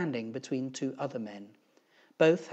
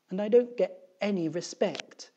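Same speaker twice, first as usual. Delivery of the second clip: high, louder complaining teacher voice; higher-pitched put-upon schoolteacher; aggrieved teacher; 8 semitones higher, 5 dB louder than usual. A narrator's reading. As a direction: voice an upset teacher airing a grievance. Upset teacher complains to three men.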